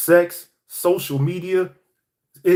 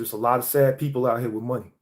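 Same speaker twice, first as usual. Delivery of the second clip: normal talking voice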